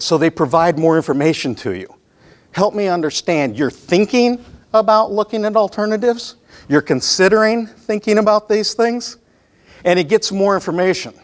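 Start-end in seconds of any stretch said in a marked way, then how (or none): 2.5-9.2 higher-pitched, mildly puzzled questions; puzzled questioning. Acted. Salesperson asking the client to explain more, puzzled questioning. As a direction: echo it back as if not quite getting it.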